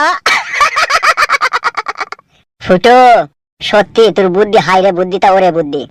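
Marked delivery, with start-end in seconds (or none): 0.1-2.1 very high gleeful villain laughter; evil laugh. Acted. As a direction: cackle with wicked delight. Villain laughs again at plot.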